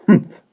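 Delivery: scornful humph. A narrator's reading. Mary Poppins scoffs.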